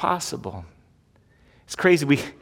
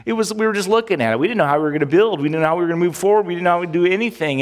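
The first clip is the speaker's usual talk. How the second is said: rushed retelling; 2 semitones higher, faster than usual. Retold or imitated. Retelling church's panic.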